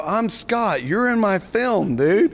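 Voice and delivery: high and loud, slowed for the reveal; retold excitement. Scott tells Fooney he is in his film, excited and slow. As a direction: spell out the big news with delight.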